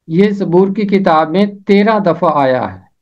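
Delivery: ordinary delivery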